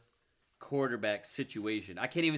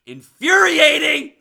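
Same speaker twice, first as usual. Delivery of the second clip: much higher, much louder exasperation; furious outburst; 21 dB louder, slower than usual. Real, not acted. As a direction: blow up in frustration. Frustrated rant about quarterback.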